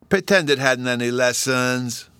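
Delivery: put-on wheedling little-boy appeal; earnest pleading. A narrator's reading. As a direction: beg like a small boy, stretching the end. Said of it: "Bruno earnestly pleaded".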